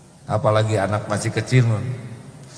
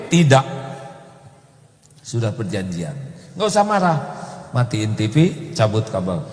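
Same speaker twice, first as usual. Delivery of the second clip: normal talking voice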